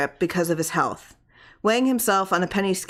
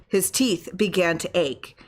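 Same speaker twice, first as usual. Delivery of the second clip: ordinary delivery, nothing marked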